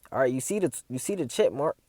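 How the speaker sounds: usual speaking voice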